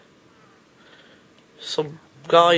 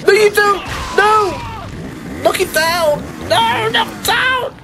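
high, frantic shout; panicked shouting; 13 semitones higher, slower than usual. Real, not acted. Player fending off chainsaw guy, panicked. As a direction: shout in rising panic, pitch way up.

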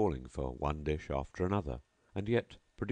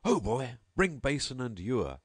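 raised call to a servant, higher; host calling his servant, lightly raised; 6 semitones higher than usual. Acted. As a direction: summon the boy across the hall. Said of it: "So he cried".

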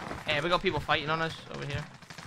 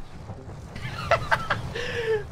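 high-pitched burst of laughter. Real, not acted. Gamer spawned in gas, bursting out laughing. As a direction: crack up at the absurdity. Player laughs at absurd respawn.

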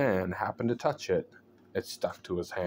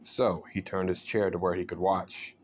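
usual speaking voice

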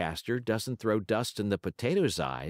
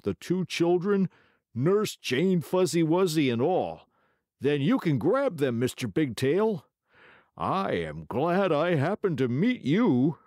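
high, slow scheming weasel; sly weasel voice; 9 semitones higher, 5 dB louder than usual. Acted. Weasel voice in story.

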